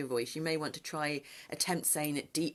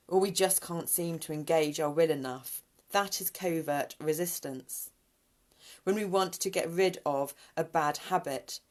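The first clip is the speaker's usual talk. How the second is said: reading aloud; 3 semitones higher, 4 dB louder than usual. Acted. Reads oracle text aloud.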